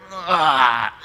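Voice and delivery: guttural "ugh" of revulsion; disgusted retch. Retold or imitated. Preacher mimicking the end of vomiting, disgusted retch. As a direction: retch at the taste of bile.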